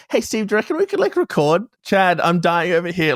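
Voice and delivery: hammed-up desperate self, begging a friend; lightly mocking his own nagging; mock whiny plea. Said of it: "little old me being like".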